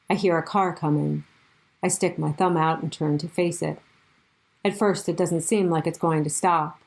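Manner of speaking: plain reading voice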